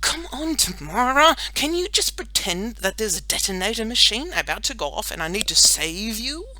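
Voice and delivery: high, pleading exasperation; frustrated. A narrator's reading. Boy begs girl to play along, frustrated. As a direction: whine for cooperation. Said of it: "Batty was getting frustrated".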